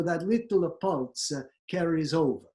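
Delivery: Italian accent with vowel pulses after consonants; cartoonish Italian accent. Shown as a demonstration. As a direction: keep up the demo Italian lilt. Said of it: "cartoonish versions of the accent".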